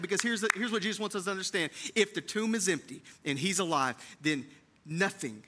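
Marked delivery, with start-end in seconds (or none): none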